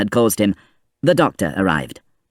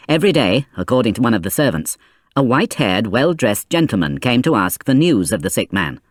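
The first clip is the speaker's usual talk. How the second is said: ordinary delivery, nothing marked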